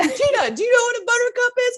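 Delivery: high, rushed mock yelling; retold yell. Imitating the one who stopped the story midway, high mock yell. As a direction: imitate frantic yelling, half laughing. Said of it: "you yelled, like".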